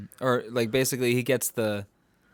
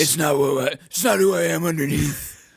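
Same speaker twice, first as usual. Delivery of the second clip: louder gravel-voiced Batman, laughing midway; Batman impression; 3 semitones higher, 4 dB louder than usual. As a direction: growl the line like the Dark Knight, breaking into a laugh. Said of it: "His voice wasn't that bad".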